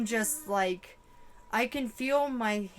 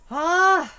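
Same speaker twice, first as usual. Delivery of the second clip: sudden loud high yelp; startled cry; 8 semitones higher, 12 dB louder than usual. Real, not acted. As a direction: yelp as if jolted. Startled by vision.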